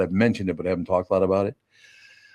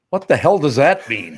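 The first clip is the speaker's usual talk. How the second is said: usual speaking voice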